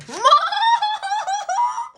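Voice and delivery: sudden high squealing laugh; excited laugh burst. Real, not acted. Reader laughs at her own YouTube idea, high excited laugh. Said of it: "I just got excited then".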